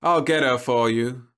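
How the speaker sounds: stretched-out offhand offer; drawled. A narrator's reading. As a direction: toss it lazily over the shoulder. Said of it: "drawled over her powder-sprayed shoulder".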